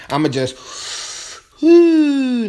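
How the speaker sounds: slow exhaled whew; long sigh. Real, not acted. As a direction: breathe out, let it sink in. Exhales to let point land.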